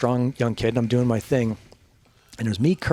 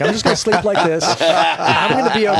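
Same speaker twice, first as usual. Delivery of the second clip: laughing; 5 semitones higher, 7 dB louder than usual. Real, not acted. Laughing at his own fear.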